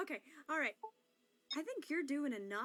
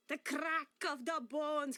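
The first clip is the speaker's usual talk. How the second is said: slow and heavy; much slower than usual. Shown as a demonstration. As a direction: drag out each word slowly. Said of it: "just lay it on".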